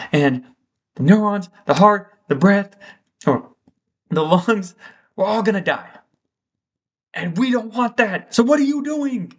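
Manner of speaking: freaking out. Acted. Neurons begging their owner not to jump, acted freak-out. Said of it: "all the neurons in your brain are freaking out".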